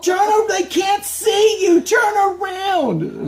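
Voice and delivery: very high, slow, stretched shriek; frantic little stage mom, hammed-up yell; imitated screaming. Older sister correcting the girl facing backwards, mock screaming. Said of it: "and she's like".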